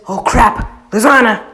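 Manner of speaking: sudden loud cry of alarm, pitch jumping up; alarmed shout. Retold or imitated. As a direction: shout her name as he rushes in to save her. Natsu sees Elfman about to kill her.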